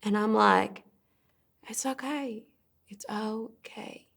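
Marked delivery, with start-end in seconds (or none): 1.7-4.1 very soft, hushed reassurance; soothing. Acted. Daughter holding her sobbing father, soothing hush. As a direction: soothe him tenderly, slowing on the repeat.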